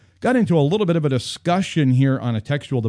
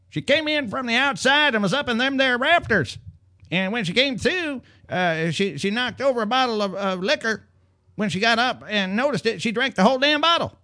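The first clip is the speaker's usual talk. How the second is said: much higher, put-on country twang; 11 semitones higher than usual. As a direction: play the owner as a hillbilly. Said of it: "made the following statement".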